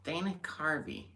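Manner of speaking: lightly attempted high celebrity voice; Dana Carvey. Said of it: "that's more his voice".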